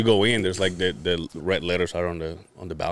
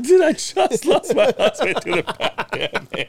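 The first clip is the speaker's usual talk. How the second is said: high, laughing quote; mock widow reply cracking up; laughing retelling; 14 semitones higher, 3 dB louder than usual. Retelling the widow's reply to his friend, laughing. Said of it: "He's like".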